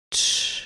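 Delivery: unvoiced "shh" to soothe; quiet calming shush; soft hissed shush. A narrator's reading. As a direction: hush someone gently with a hiss. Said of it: "прошипел Эдгар".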